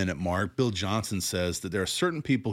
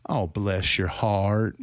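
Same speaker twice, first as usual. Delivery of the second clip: slow, syrupy Southern "Oh, bless your heart"; much slower than usual. Retold or imitated. Critic imitates Southern sweet condescension, slowly. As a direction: draw it out like a Southern grandma. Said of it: "they'll say".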